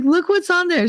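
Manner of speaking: high, imitated child's voice; excited little girl. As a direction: point it out in a high kiddie voice. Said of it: "she's like".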